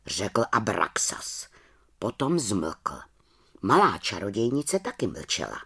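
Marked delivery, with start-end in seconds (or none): none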